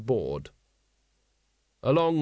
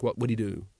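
usual speaking voice

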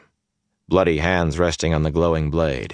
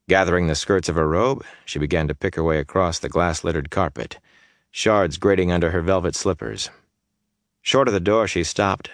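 ordinary delivery

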